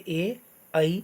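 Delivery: "ए" and "ऐ" from throat and palate together; throat-palate vowels. Shown as a demonstration. Teacher showing vowels made with both throat and palate, throat-palate vowels. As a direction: say "ए", then "ऐ", as separate model vowels. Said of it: "कंठ और तालु दोनों से".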